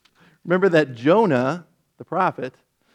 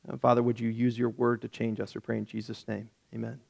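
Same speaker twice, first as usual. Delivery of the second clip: prayer; 2 semitones lower than usual. Real, not acted. Leads the group in prayer.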